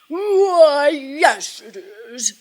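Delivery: high, loud, swooping dragon voice; big showy dragon reply; boastful dragon. A narrator's reading. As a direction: puff up proudly as a storybook dragon.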